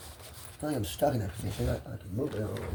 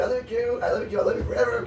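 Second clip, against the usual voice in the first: high, sweet declarations of love; tender; 13 semitones higher, 4 dB louder, faster than usual. Acted. Answering a lover, a high sweet coo. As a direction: coo it to her.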